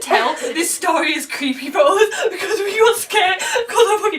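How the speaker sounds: laughing. Real, not acted. Laughing and teary.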